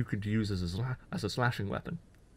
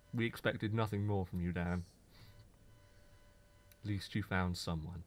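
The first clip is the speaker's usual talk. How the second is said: lightly voiced disappointed parent, lower and quieter; resigned parent; 4 semitones lower, 5 dB quieter than usual. Imagined parents answering their child, mildly resigned. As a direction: let it sink, as if giving up on them.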